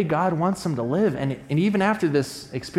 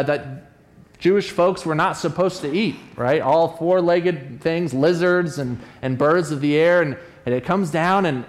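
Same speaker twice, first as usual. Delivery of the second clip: normal talking voice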